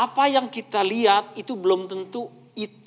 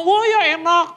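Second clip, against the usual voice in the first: high, louder show of enjoyment; feigned delight; 8 semitones higher, 5 dB louder than usual. Acted. Speaker faking praise of Yansui to spouse, feigned delight. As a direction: put on delighted praise for the food. Pretends to praise hated dish.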